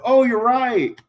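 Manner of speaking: high, loud mock yelp of concession; retold sudden realization. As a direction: cry out as if falling mid-realization. Sudden realization while falling.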